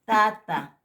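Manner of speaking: "tata" said loudly with a Spanish-style t; plain t model. Shown as a demonstration. Teacher modelling the plain t. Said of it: "como en el castellano".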